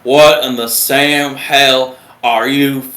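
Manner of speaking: drawn-out, loud Southern drill-instructor chewing-out; thick Southern accent. Retold or imitated. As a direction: imitate a Southern petty officer dressing down recruits. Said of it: "He's got the thickest Southern accent".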